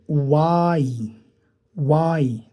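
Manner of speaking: "वाई" drawn out very slowly, lips rounded, then a sudden release; slow demo y sound. Shown as a demonstration. Teacher slowly models the y sound for the class. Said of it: "होंठों को गोल करके फिर suddenly इसको release".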